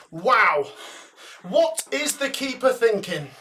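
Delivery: ordinary talk, nothing marked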